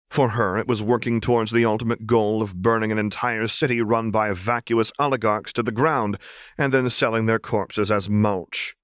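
ordinary delivery

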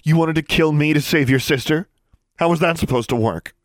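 high, loud accusing fury; angry, vengeful. A narrator's reading. Alexandra accusing Darla, high loud vengeful anger. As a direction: confront her with disgust. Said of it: "angry and vengeful".

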